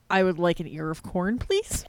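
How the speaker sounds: imagined McCoy order. Pretend. McCoy asking the replicator for corn, low deadpan. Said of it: "him, like, at the replicator being like".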